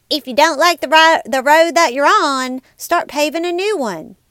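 [name] twang. Retold or imitated. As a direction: try a jokey Southern country accent, badly done. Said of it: "should I say it in [name] twang".

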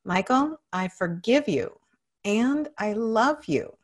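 full-voiced, emphatic affirmation. Shown as a demonstration. Client declares self-forgiveness after coach, with strong conviction. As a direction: declare it, stretching the last phrase. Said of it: "say it with even more conviction".

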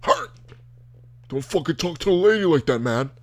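hammed-up bro defending a lady; justice bro. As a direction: confront him like a self-righteous bro. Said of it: "Some justice bros are gonna".

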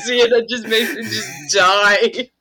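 talking through laughter; words breaking into laughs; laughing. Real, not acted. Cracking up at the joke.

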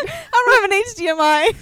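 high, loud laughing voice; laughing hard. Real, not acted. Riffing on friend's STD joke, laughing hard. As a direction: squeal it through real laughter.

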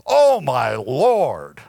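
very slow, heavy emphasis on "Oh, my Lord"; emphatic. Shown as a demonstration. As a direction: lean hard on OH, then let LORD sink. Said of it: "pick up the emphasis".

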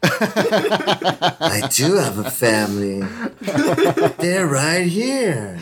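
very slow, drawn-out pretend insistence; mock protest. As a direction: stretch every word in hammed-up denial. Mock protest about imaginary family.

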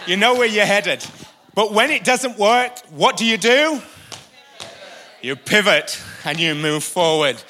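loud, high preacher's call; fiery preaching. Acted. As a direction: drive the point home from the pulpit. Preaching at full force.

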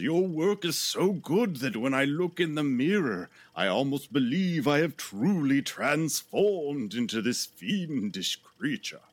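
lightly voiced theatrical showman; TV host voice. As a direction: gush it like an old TV host. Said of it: "Hinkle says".

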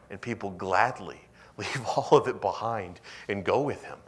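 ordinary talk

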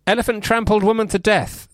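high-pitched headline announcement; headline read. Acted. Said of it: "I'm gonna read a headline".

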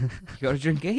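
playful mock Canadian question; lightly Canadian, landing on the "eh?"; Canadian accent. Shown as a demonstration. Co-host demonstrating the eh habit, Canadian accent. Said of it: "putting the A on everything".

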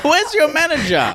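shrill high demand; angry customer. Pretend. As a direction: jab the question at the rep. Playing angry Apple customer.

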